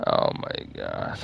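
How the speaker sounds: low, slow, dismayed murmur; acted dismay, almost under the breath; let down. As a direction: sink into a hushed, drawn-out groan of disappointment. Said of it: "And then what a letdown".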